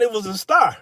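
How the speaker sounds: ordinary talk, nothing marked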